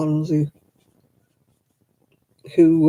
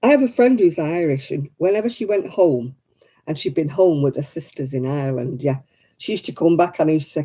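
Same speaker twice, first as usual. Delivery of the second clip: normal talking voice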